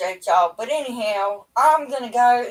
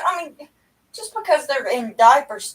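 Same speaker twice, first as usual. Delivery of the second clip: normal talking voice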